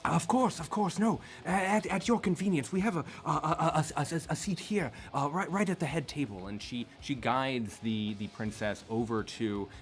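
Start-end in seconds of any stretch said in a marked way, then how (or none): 0.0-6.5 high, stammering, eager to please; flustered servant. Acted. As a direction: trip over words nervously. Nightbloom stammering subservience.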